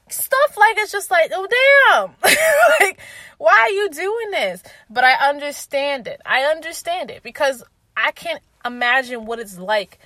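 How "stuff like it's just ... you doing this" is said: exasperated outburst. Real, not acted. Exasperated reaction to mom's jokes.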